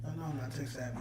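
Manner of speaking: ordinary talk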